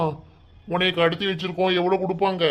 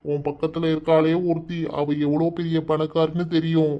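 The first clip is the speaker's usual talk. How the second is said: ordinary talk, nothing marked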